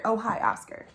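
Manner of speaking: usual speaking voice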